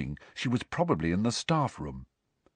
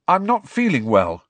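high, loud claim of illness; loud announcement; 7 semitones higher, 6 dB louder than usual. A narrator's reading. Pupil telling teacher he is unwell, loudly.

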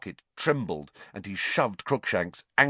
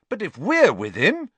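higher, louder and spirited; rally your friend boldly; spirited boast to Hermione; 11 semitones higher, 6 dB louder than usual. Acted. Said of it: "said Ron spiritedly to Hermione".

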